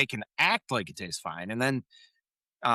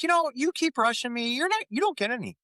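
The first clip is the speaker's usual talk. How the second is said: teasing scold; 12 semitones higher, faster than usual. Retold or imitated. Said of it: "she's like".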